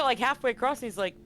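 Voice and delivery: normal talking voice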